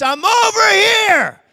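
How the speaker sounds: high, loud, slow call; long carrying shout; yelling back. Retold or imitated. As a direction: shout it as loud as you can across the woods. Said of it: "started to run towards that voice".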